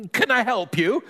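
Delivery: high squeaky polite question; boy piping up high. Acted. Boy greets stranger at the door, squeaky high voice. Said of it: "in a squeaky high voice".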